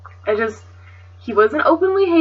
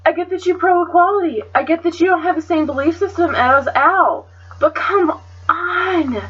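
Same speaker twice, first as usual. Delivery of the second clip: higher, fed-up retold scolding; exasperated retort; 6 semitones higher than usual. Narrator scolding her friend Sam, retold exasperation. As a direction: let the disbelief pour out. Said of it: "And I'm just like".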